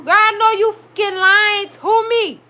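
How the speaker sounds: high, loud accusation; incredulous outrage. Real, not acted. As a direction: call out the lie. Disbelief at the show.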